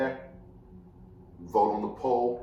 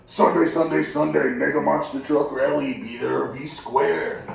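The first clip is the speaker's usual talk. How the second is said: loud, higher monster truck rally promo; 4 semitones higher, 7 dB louder than usual. Acted. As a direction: hype it like a radio ad, slowing at the end. Spoof radio commercial.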